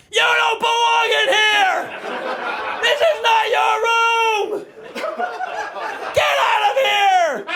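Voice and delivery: high, slow hammed-up yell at the dog; mock yelling. Owner scolding dog, hammed-up drawn-out yell. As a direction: bellow like an alpha, drawn out. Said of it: "and just yelled at him".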